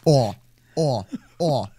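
ordinary delivery

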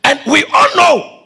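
high, shouted complaint to Jesus; impassioned preaching. Real, not acted. Preacher voicing the sisters awaiting Jesus, impassioned preaching. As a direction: build the reproach through insistent repeats.

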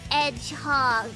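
normal talking voice

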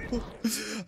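soft, high helpless laugh; disbelieving laugh. Real, not acted. Player laughing at his own beating, helpless. Laughs after being disrespected.

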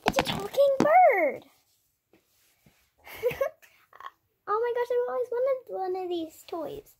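high, thrilled squeal; excited. Pretend. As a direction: gush over the new toy. Thrilled about talking bird.

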